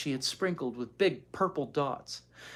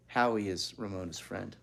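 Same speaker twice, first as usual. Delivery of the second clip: ordinary delivery, nothing marked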